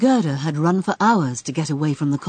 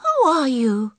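startled cry; 8 semitones higher than usual. Acted. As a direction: call out, woken and alarmed. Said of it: "she cried".

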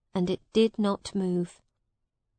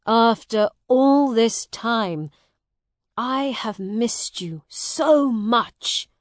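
slow, louder, heartfelt greeting; heartfelt reunion; 3 semitones higher, 7 dB louder, slower than usual. Acted. Long-lost brother greets Hugh after years away, heartfelt. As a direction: let the longing pour out slowly.

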